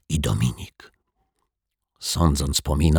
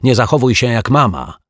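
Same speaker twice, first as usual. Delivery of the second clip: higher, irritated taunt; 6 semitones higher, 4 dB louder than usual. A narrator's reading. Dominik tells off his bossy sister, taunting. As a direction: needle her with a raised pitch.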